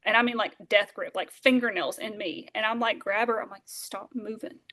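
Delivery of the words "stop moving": much softer hushed command; whispered order. Retold or imitated. Girl telling her sister to freeze, whispered. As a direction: hush her without a sound.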